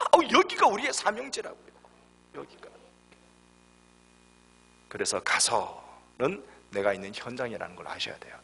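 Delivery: usual speaking voice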